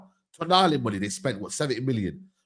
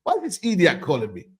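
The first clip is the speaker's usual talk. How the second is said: ordinary delivery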